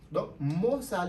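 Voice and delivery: ordinary talk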